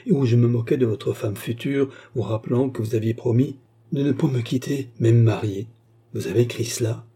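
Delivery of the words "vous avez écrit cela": plain reading voice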